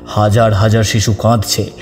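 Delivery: usual speaking voice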